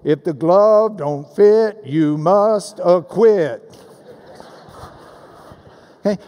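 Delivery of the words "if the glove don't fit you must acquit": slow, punched rhyming slogan; measured sing-song courtroom rhyme; sing-songy. Shown as a demonstration. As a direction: draw out each beat of the rhyme. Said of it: "there's little sing-songy ways".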